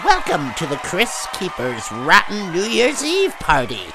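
booming ghoulish host greeting; horror host voice. Acted. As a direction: welcome them like a creepy TV host. Horror host opening, shouted.

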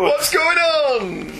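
high, slow, confused caveman voice; bewildered caveman. Retold or imitated. Host repeats the caveman line, high and drawn out. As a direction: drag it out like a baffled caveman.

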